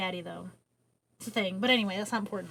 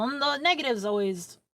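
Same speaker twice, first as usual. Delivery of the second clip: usual speaking voice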